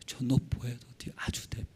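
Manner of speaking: hushed, near-whispered plea; pleading prayer. Acted. Preacher modelling a believer asking God for help, hushed pleading. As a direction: murmur it helplessly to God.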